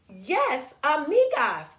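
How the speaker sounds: put-on excited girl character. Girl in the picture book answering yes to friendship. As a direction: pipe up happily as the little girl. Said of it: "And Susan says".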